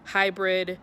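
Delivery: bright, loud channel welcome over music; cheerful host voice opening the video; upbeat vlog intro. Acted. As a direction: greet your viewers like a YouTube host.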